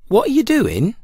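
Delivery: high curious cauliflower; cauliflower's voice. A narrator's reading. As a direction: ask it wide-eyed like a cauliflower.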